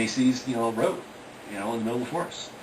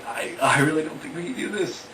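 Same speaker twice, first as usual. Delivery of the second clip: scared; 7 semitones higher than usual. Retold or imitated. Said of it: "just freaking out".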